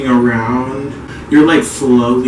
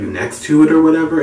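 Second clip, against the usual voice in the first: usual speaking voice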